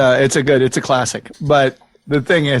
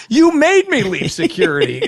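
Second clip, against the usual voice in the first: mock outraged retort; 10 semitones higher than usual. Retold angry retort.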